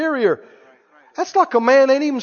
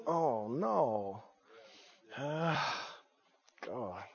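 hushed dismay; 10 semitones lower, 19 dB quieter, much slower than usual. Pretend. Voicing a grieved response.

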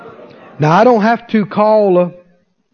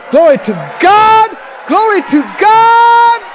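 climbing high and loud, drawn out; rising praise shout; 14 semitones higher, 5 dB louder, slower than usual. Real, not acted. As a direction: build to a ringing cry.

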